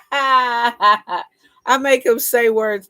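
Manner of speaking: high burst of laughter, then slow laughing words. Real, not acted. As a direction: laugh it out while talking. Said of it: "laughing at husband".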